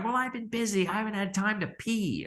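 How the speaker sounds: high, defensive whine; doctor excusing lateness, defensive; mock defensive excuse. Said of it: "they're like".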